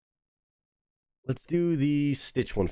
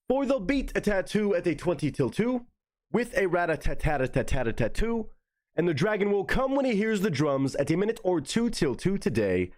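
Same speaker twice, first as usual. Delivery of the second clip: higher, sing-song tongue-twister recital; vocal warm-up recital; 4 semitones higher than usual. Shown as a demonstration. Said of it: "let me do 'em the regular way".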